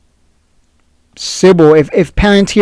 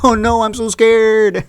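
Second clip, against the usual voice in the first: slow, higher mock-frightened whimper; mocking scared investors, slow and higher; 5 semitones higher, slower than usual. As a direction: imitate a panicky investor, hammed-up. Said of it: "the people who are like".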